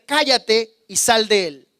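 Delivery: slow, loud commanding rebuke; loud, slow command to an evil spirit; authoritative rebuke. Shown as a demonstration. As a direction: cast out the spirit with calm authority. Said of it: "Reprendió diciendo".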